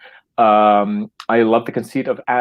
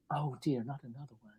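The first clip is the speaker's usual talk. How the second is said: very soft, higher mock dismay; hushed dismay; 4 semitones higher, 19 dB quieter than usual. Nurse comes in on another body, hushed mock dismay. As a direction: play a nurse sighing it almost inaudibly.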